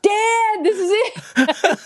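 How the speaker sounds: very high, excited imitation; mock starstruck outburst; thrilled son. Son-in-law thrilled by discovery.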